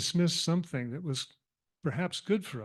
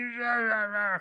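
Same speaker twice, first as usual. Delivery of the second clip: higher, hammed-up rah-rah snarling; the offended cat hissing rah rah at the kitten; mock cat snarl; 6 semitones higher than usual.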